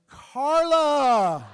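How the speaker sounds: loud, high, long holler; yelled call. Retold or imitated. New comic freezes at the mic and copies the last act, yelled. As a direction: bellow the name out in imitation. Copying the failing comic's yell.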